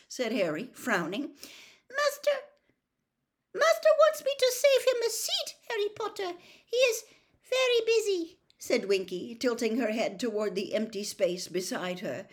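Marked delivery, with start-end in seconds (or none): 1.9-8.4 very high, halting elf voice; Winky's squeak. A narrator's reading. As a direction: explain loyally in a tiny squeak. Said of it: "quivering squeak of a voice".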